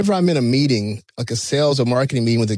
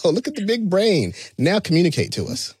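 sarcastic mock; 6 semitones higher than usual. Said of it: "I was like".

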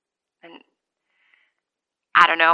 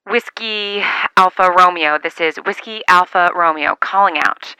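over a radio; 4 dB louder than usual. Acted. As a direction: announce the call sign carefully. Calling out on CB radio.

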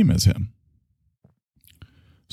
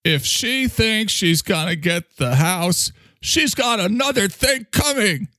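high, slow, bitter street-corner rant; mimicking the homeless man; 11 semitones higher, slower than usual. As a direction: play the resentful homeless man muttering about his wife. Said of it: "and he says".

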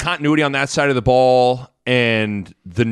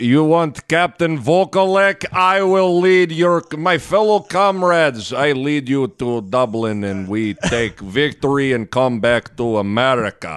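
barbarian; slower than usual. Acted. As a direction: boom it out like a conquering chieftain. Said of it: "I have created such a ridiculous character".

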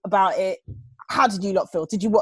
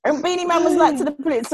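laughing through the words, high; 8 semitones higher, 4 dB louder than usual. Real, not acted. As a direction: tell it while laughing. Laughing while retelling.

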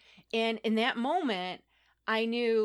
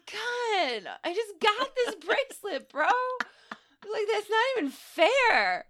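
put-on high, slow whine like a sulking kid; whiny plea; 12 semitones higher, 4 dB louder than usual. Wanting to keep the gift, high childish whine. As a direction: whine for the bracelet.

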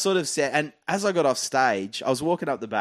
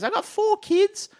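much higher, slow, proudly listing names; bogan mum; 4 dB louder, much slower than usual. Retold or imitated. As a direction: play a proud mum announcing her kids to the room. Mum in crowd lists her kids.

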